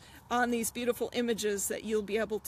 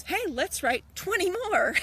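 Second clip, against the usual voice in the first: higher, bouncy pitch swoops; imagined eager editor; 7 semitones higher, 4 dB louder than usual. Acted. Editor eagerly proposing twenty more books, excited. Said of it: "my editor goes".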